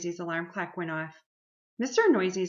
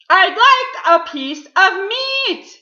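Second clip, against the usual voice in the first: slow, booming high shout; Mister Noisy; 13 semitones higher, 18 dB louder, much slower than usual. A narrator's reading. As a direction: holler each word at full blast. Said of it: "boomed Mister Noisy".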